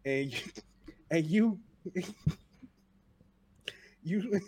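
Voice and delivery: normal talking voice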